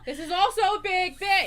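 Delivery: high, loud, excited. Real, not acted. Excited about the big flag.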